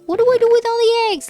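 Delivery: high, loud mock-frantic outcry; mock panic. Streamer swamped by overproductive chickens' eggs, mock panic. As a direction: wail it in hammed-up overwhelm. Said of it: "And I'm like".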